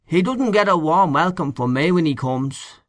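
lightly voiced disapproving old caretaker; grumbling old man. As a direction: grumble it with an Irish lilt.

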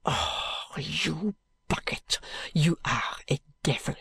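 soft unvoiced French muttering. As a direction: mutter the curse under your breath.

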